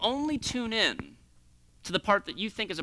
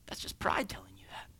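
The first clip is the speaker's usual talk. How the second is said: softly whispered aside; 13 dB quieter than usual. Real, not acted. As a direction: lean in and whisper it. Aside on pride.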